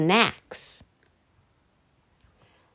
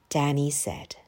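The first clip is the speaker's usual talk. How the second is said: usual speaking voice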